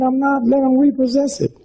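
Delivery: breezy, laughing defiance; half-laughing "let 'em repossess it"; carefree shrug. Retold or imitated. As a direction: toss it off like it's nothing. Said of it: "I said".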